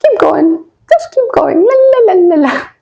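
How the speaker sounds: high, lilting la la la, unbothered; carefree sing-song. Retold or imitated. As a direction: playfully hum through the chaos. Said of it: "so much that you're like".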